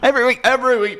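high-pitched comic catchphrase impression; Anton Du Beke. Podcaster keeps the requested impression going, high comic voice. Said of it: "Tony Beak impression".

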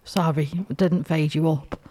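ordinary delivery

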